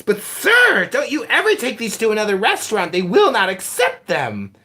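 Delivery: hammed-up formal apology; imitating the manager. Mimicking the restaurant manager telling him his money is fake, high and prim. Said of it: "And he goes".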